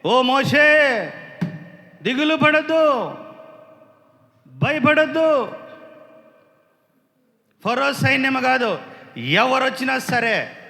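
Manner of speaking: high, loud, slow preaching call; a preacher lifting his voice over the music; full-force preaching. Acted. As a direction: proclaim it slowly to the whole church. Preaching on Moses at sea.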